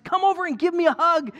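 high-pitched imitation Australian accent. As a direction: invite the kids over in mock Australian. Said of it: "he's got this Australian accent".

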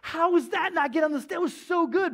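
high, loud incredulous outburst; exasperated disbelief. Retold or imitated. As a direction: sound floored that the clip got cut. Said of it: "And I'd be like".

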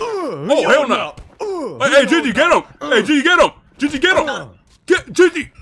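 high, loud frantic yelling; loud frantic high-pitched yelling; panicked shout. Acted. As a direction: scream for backup. Panicked call for help in fight.

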